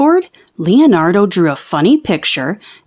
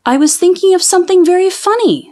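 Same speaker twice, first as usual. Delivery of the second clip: put-on high, fast, bubbly girl voice; giddy girl; 10 semitones higher, faster than usual. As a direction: blurt it out like a delighted kid. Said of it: "said the girl".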